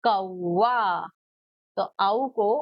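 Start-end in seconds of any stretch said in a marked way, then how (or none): 0.0-1.1 teacher drawing out a vowel; slow drawn-out. Shown as a demonstration. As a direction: stretch कौआ very slowly, holding the औ. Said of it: "तो ये कैसे पढ़ा?".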